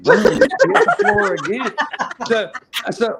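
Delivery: real laughter breaking into the story; laughing while telling. Real, not acted. Laughing retelling a fan collapsing.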